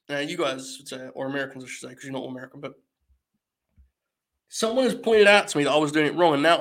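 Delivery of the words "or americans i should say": ordinary talk, nothing marked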